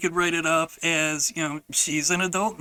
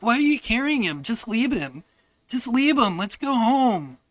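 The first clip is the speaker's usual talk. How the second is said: higher, put-on child's protest; mimicked complaining; 6 semitones higher, 4 dB louder than usual. Sister telling Grandpa to leave him, mimicked protest. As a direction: imitate a kid objecting impatiently. Retold sister urging abandonment.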